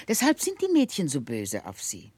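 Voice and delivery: normal talking voice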